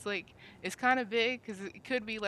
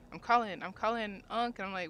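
normal talking voice

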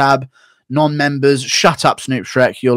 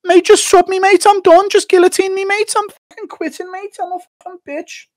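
very high, squeaky, pleading Scouse voice; Darren Till impression; 5 dB louder than usual. Streamer mocking Darren Till begging Hamzat for a sub, very high. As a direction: squeal like a beaten fighter begging to be finished. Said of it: "Till shooter takedown on Hamzat and Jim impression".

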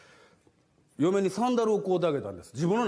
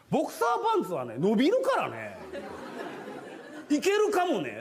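imitating wife; 11 semitones higher than usual. Wife voiced in story.

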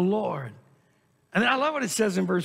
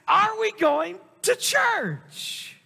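high, drawn-out whiny "Are we going to church?"; mock whiny question; 11 semitones higher, much slower than usual. Preacher mimicking a reluctant churchgoer asking, mock whiny. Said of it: "And then you ask".

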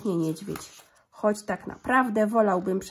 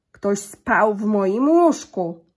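very slow, much louder grumbling papa bear; gruby zrzędliwy głos; 11 dB louder, slower than usual. A narrator's reading. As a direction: boom it out slowly like an angry big bear. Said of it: "grubym, zrzędliwym głosem". (tata niedźwiedź grzmi bardzo wolno i głośno)

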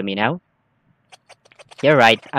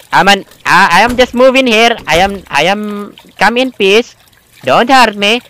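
loud, higher, half-joking panic; panicked pleading; 7 semitones higher, 7 dB louder than usual. Pretend. Scared player shouting into the dark flat, panicked. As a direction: beg the unseen thing not to hurt you, loudly.

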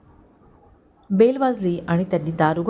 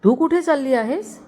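jolted, higher voice; startled question; 7 semitones higher than usual. A narrator's reading. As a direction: ask it in alarm, pitched up. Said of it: "त्यांनी चमकून विचारले".

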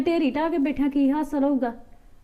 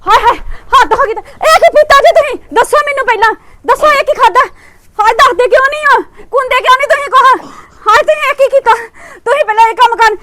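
panicked screaming; 11 semitones higher, 11 dB louder than usual. Acted. Wife finds husband took poison.

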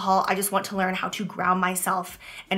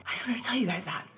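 breathy whisper as emotion takes over; 3 semitones higher, 7 dB quieter, faster than usual. Real, not acted. Telling viewers about her struggle, a soft whisper. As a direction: let it fall to a whisper, near tears. Emotional confession, voice drops away.

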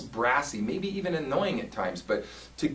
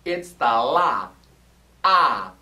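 bright, ringing ah; 2 semitones higher, 9 dB louder, much slower than usual. Shown as a demonstration. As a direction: sing a bright, brassy ah. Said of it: "that really bright sound".

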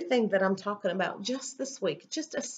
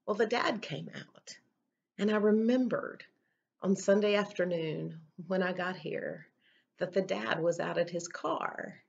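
ordinary talk, nothing marked

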